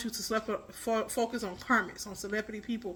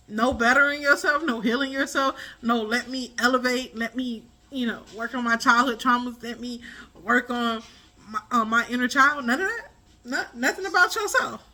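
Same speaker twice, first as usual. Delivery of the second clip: louder, rising indignant rant; 6 dB louder than usual. Real, not acted.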